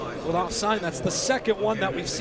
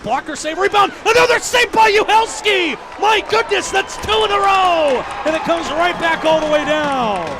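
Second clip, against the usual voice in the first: loud, high shouting call of back-to-back saves; full-voiced hockey call; excited play-by-play; 8 semitones higher, 8 dB louder than usual. Real, not acted. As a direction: roar the double save. Second save call.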